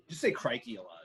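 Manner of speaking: normal talking voice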